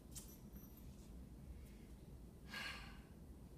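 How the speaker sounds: long, slow breath out; long deep sigh. Retold or imitated. Wife calming herself after taking a deep breath: long, slow sigh. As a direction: let it all out in one long sigh.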